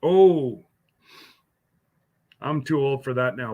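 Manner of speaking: usual speaking voice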